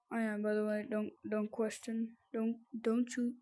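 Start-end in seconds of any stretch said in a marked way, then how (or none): none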